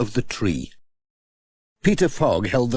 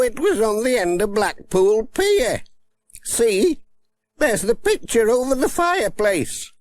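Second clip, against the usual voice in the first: put-on high, cheery old grandmother; a sprightly elderly woman, much higher; old granny voice; 10 semitones higher than usual. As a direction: play her as a spry old granny. Granny Dryden voiced in story.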